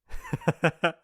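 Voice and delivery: high, derisive chuckle; mocking laugh. Bully hearing a nervous accusation, mocking laugh. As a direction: laugh right at them.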